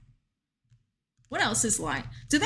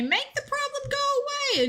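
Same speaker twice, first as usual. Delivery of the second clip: much higher put-on voice for "Make the problem go away"; mock May voice; faster than usual. Streamer quoting chatter May's wish to Jirachi in a squeaky put-on voice. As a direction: play May as a sly villain making a wish. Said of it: "May really did say".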